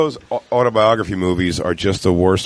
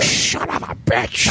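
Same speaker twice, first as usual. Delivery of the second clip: gruff movie quote; 4 dB quieter, slower than usual. Retold or imitated. Quoting a Tom Hardy scene.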